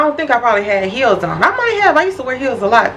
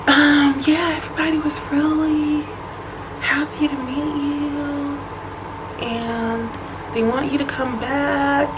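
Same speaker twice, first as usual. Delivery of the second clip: soft slow friend imitation; 4 semitones higher, 7 dB quieter, slower than usual. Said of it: "she was like".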